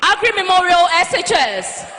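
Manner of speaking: hype call-out. Real, not acted. MC calling out to crowd.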